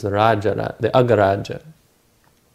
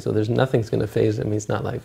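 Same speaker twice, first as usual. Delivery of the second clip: normal talking voice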